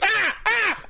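high, pained goblin shrieks; screaming redcap. Acted. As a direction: squeal for mercy. Redcap being kicked screams.

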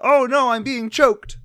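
loud, high mock cry of panic; mock alarm. Host plays the dad suddenly grabbed, mock panicked. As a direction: burst out in hammed-up alarm.

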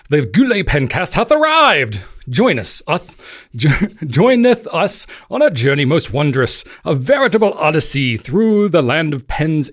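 put-on grand Shakespearean proclamation in amateur British accent; attempted British accent. Host reading a Shakespearean show intro, hammed-up British accent. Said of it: "somewhat of a British accent".

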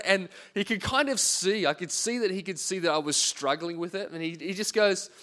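normal talking voice

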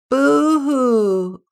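high loud wailing boo-hoo; crying boo-hoo. A narrator's reading. Lonely beaver wailing across the pond. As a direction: wail it like a sad little beaver. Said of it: "said Little Beaver".

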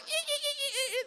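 very high-pitched squealing dolphin chatter; mock dolphin laughter. Happy dolphins always laughing, high squeaky chatter. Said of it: "They're always laughing".

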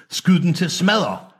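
high, heated command; barked angry order. A narrator's reading. Commander ordering the balloon shot down, angry high order.

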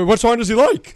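high, loud mock query; retold question. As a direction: pitch it up and push it out. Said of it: "Or I go".

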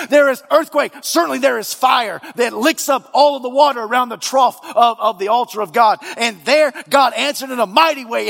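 high, loud fervent preaching. Acted. Preacher to congregation, fervent and loud. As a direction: build it up and thunder at the congregation.

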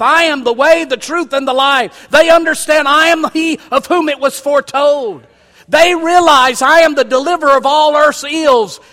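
high, loud proclamation from the pulpit; preaching at full force. Acted. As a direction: proclaim each claim to the congregation.

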